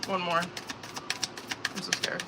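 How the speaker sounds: whispered, very slow and very soft; scared whisper. Real, not acted. Tarot reader whispering her fear to viewers, scared whisper. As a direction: breathe it out in a frightened hush. Nervous reading, scary card drawn.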